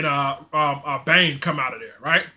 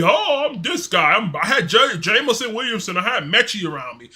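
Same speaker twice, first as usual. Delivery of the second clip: imagined boastful player; 4 semitones higher than usual. Acted. Streamer imagining the quarterback boasting about his talent, mock bragging. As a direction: show off in a pitched-up, swinging voice. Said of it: "he could easily be".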